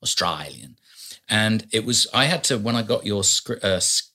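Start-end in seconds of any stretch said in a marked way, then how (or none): 0.0-0.8 "Australian" as "Austr-eye-lian", lower and softer; "Australian", its A swapped for a long I. Shown as a demonstration. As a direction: drop low and say "Australian" with an eye vowel. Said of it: "A, he says I".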